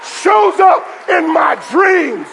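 shouted pulpit declaration; full-force preaching. Real, not acted. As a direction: fire up the congregation phrase by phrase.

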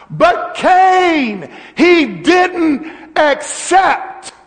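high, loud, slow preaching cry; loud, slow sermon climax with God's words shouted; full-force preaching. Acted. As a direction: proclaim it to the back row, slow and ringing.

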